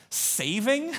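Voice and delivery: acted puzzled scoff; incredulous question. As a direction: query it as if absurd. Acted doubter questions needing saving.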